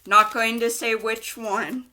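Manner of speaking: ordinary talk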